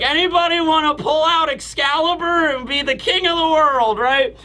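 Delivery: high, carrying call of a tipsy show-off; rally the crowd like a merry drunk; drunken boasting. Retold or imitated. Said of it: "Usopp's still drunk and he's like".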